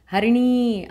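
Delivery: sudden high, loud shout of a name; loud cry. A narrator's reading. Waking patient cries out for Harini, a loud shout. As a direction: cry out the name as if the crash just happened. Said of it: "గట్టిగా అరిచాడు".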